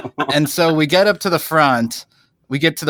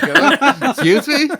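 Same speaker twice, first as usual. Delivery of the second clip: much higher, louder incredulous excuse me; mock disbelief; 13 semitones higher, 4 dB louder, slower than usual. At the drive-through window, hearing the worker's strange excuse: high mock disbelief. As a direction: act baffled at what you just heard. Reacting to worker's odd remark.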